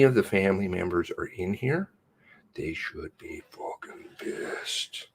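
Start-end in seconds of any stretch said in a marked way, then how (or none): 2.5-5.1 seething whisper. Real, not acted. Seething aside.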